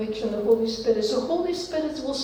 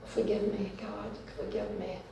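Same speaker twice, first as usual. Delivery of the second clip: hushed, fading plea for forgiveness; quiet prayer; 2 semitones lower, 10 dB quieter than usual. Acted. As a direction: murmur the prayer, sinking softer. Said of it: "And then we say".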